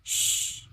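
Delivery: "쉬" as a voiceless hushing sh; "쉬" sessiz bir şşş sesi; sh sound. Shown as a demonstration. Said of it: "쉬라고 소리가 납니다".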